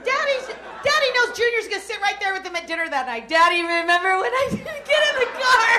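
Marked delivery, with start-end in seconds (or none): 3.3-5.7 put-on laughing child voice; a kid cracking up at the memory; giggling kid. Kid reminiscing with dad.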